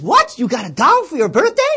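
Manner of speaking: loud, high astonished cry; startled. Acted. Startled brother shouting at his sister's answer. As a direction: blurt it out in shock.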